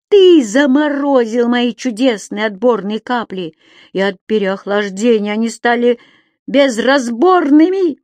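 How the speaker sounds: loud, high, drawn-out rant; furious accusation. Acted. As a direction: spit the insult at him. Creature angrily accuses Daume.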